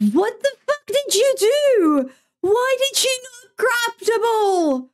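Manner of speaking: high-pitched wail of outrage; real anger. Real, not acted. Streamer to the goalkeeper after a blunder, anguished high wail. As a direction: wail each word in furious disbelief. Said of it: "I am so fucking pissed".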